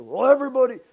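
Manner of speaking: loud, high, quick mimicked excuse; mock excuse-making. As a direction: blurt it like a lame protest.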